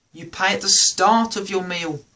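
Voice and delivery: ordinary delivery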